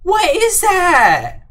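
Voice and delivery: very high, loud, drawn-out shriek; shrieked disbelief. Acted. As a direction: shriek it slowly at the top of your range. Mock alarm, repeated louder.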